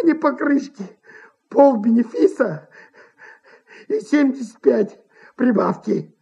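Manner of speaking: pleading oaths in character; desperate impresario. A narrator's reading. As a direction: vow and haggle frantically as a cornered man.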